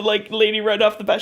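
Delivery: ordinary delivery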